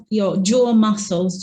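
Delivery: normal talking voice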